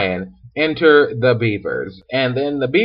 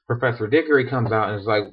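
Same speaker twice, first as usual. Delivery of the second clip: ordinary talk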